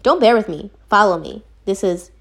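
usual speaking voice

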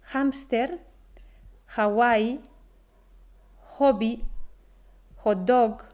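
H inicial de "hámster", "Hawái", "hobby" como jota; H aspirada. Shown as a demonstration. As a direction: aspira la H de cada palabra, como en "hot dog" con jota. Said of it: "se aspira la H y el sonido es muy próximo". (hache aspirada como jota, "jámster", "jobi")

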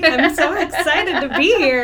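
loud, bright excited hello. Real, not acted. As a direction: burst in with excitement. Guest greeted on podcast.

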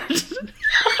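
high squeal of laughter. Real, not acted. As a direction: burst out laughing. Laughs at sad brother joke.

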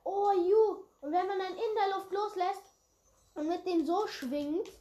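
loud, high eager explaining; excited. Real, not acted. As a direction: show off the trick excitedly. Zeigt begeistert einen Trick. (begeistert erklärend)

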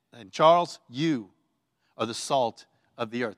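name first, then "you" alone between pauses; single the person out, dropping low after the name; direct address. Shown as a demonstration. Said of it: "I'll say it like that to you".

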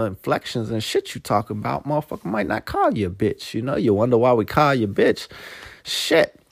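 normal talking voice

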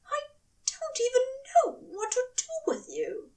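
high, unvoiced, near-tearful mutter; muttered to himself. A narrator's reading. Angel muttering to himself over his lover, near tears. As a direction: mutter it half to yourself, overwhelmed. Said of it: "muttering to himself".

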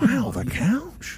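very slow, high celebrity impression; drawn-out, bewildered actor's wonder; Owen Wilson. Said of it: "he's always confused".

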